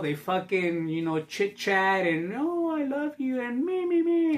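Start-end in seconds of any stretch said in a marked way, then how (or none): 2.4-4.4 high squeaky mock of gushing friends; mocking falsetto. Ranting about his ex and her coworker, sneers in a mocking falsetto. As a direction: pitch it way up, sneering and sing-song.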